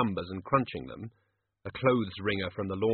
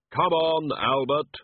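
slow, hollow, commanding; Death's voice; 5 semitones higher, 5 dB louder, much slower than usual. Acted. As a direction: speak as Death, grave and firm. Death urging his servant.

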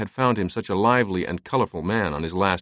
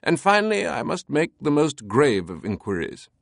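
high, dramatic British voice; grand British showman; theatrical British eccentric; 7 semitones higher than usual. A narrator's reading. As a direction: announce it with mock gravity, British accent.

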